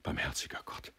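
hushed whisper; soft, stunned inner voice; horrified thought. A narrator's reading. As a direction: whisper it to yourself, appalled. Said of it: "dachte er".

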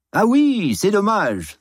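very high, hearty chuckling reply; jovial French landlord. A narrator's reading. Herr Rouve jokes along with his confused lodger, laughing. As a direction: agree with a big jolly laugh.

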